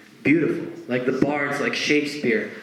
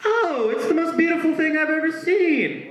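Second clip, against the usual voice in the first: much higher, syrupy fake delight; fake praise. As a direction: lay on false enthusiasm.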